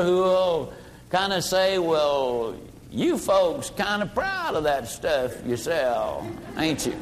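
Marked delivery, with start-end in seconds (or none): 1.8-7.0 lightly voiced down-home philosopher, slow and lower; a hint of a drawling country sage teasing the salesman; folksy old-timer. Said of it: "an old boy down home kind of a philosopher".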